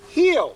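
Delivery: high, loud, snapped heel; sharp command. Shown as a demonstration. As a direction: bark the word heel at the dog. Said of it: "So if she wasn't healing".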